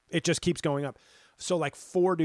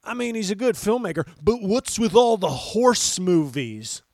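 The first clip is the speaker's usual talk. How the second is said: hammed-up puzzled moviegoer; dopey moviegoer voice; 7 semitones higher, 9 dB louder than usual. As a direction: play a confused fan, pitched up. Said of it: "and then you'd go".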